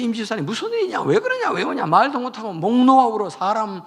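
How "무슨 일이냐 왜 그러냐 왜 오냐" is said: alarmed, much higher questions; shocked husband asking what happened; startled husband. Retold or imitated. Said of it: "남편이 깜짝 놀라지". (깜짝 놀란 남편)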